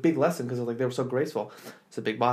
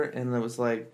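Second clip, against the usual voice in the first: ordinary talk, nothing marked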